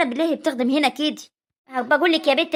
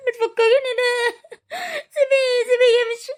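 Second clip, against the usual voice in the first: high crying protest; wailing, pitch way up; tearful outburst; 7 semitones higher than usual. Acted. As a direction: burst into tears at an unwelcome reminder. Upset daughter breaks down over husband.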